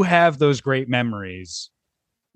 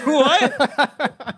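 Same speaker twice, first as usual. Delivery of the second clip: "What?" burst out through a laugh, high; a baffled laughing "What?"; hammed-up incredulous laugh on "What?"; laughing disbelief; 11 semitones higher than usual. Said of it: "I was like".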